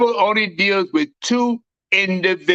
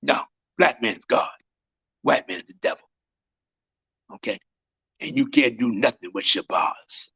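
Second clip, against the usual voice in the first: a much lower, settled lecture voice; lower, calm teaching; 8 semitones lower than usual. Acted. Calm lower teaching after sermon.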